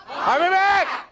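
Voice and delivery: high, loud exclaimed catchphrase; triumphant shout. Retold or imitated. Speaker cries out the customer's magic words, high loud shout. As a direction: shout it like a promise.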